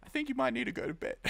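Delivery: quick, high mock-scolding; fast playful ribbing; teasing joke. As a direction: tease a viewer to go to sleep. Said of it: "I'm joking, dude".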